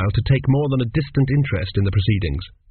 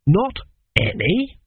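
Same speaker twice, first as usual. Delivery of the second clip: surprised; 7 semitones higher, much slower than usual. A narrator's reading. Shocked at no plumbing.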